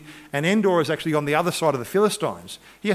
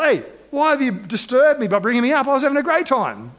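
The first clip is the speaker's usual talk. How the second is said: high-pitched, indignant mock protest; hammed-up grumbling, pitched well up; unhappy complaint; 8 semitones higher, 3 dB louder than usual. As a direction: complain like someone rudely disturbed. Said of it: "he's not really too happy about".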